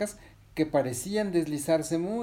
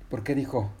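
plain reading voice

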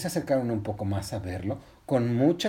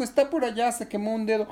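put-on little girl; 9 semitones higher than usual. Girl reports the accident to mother.

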